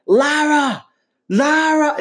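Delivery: high, loud shouted name, twice; hammed-up movie hero calling out Lara; mock Daniel Craig yell. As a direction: imitate him bellowing for Lara across the set. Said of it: "He's always like".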